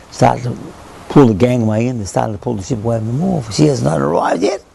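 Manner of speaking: ordinary delivery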